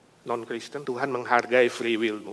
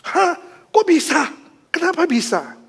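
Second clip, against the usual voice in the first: much higher, startled questions; wife stunned by husband's conversion; astonished disbelief; 8 dB louder than usual. Acted. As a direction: gasp it out in disbelief.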